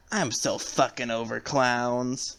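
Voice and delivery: very slow, bored put-on voice; bored drawl. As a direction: drag it out like you're over it. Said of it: "Harley Quinn just goes".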